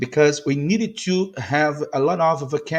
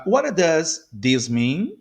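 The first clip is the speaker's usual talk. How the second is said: what does this mean, slow with spaced words; slow model; slower than usual. Shown as a demonstration. Teacher modelling a question for learners, slowly. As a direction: separate each word for learners.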